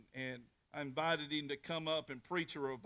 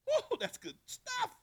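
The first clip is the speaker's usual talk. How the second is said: much higher, slow, amazed exclamation; delighted; 4 dB louder, slower than usual. Real, not acted. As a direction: marvel at your own sermon. Preacher delights in own point.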